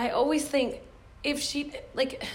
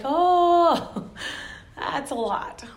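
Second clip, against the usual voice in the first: overwhelmed gasp; 6 semitones higher, 5 dB louder, much slower than usual. Retold or imitated. Overwhelmed reaction.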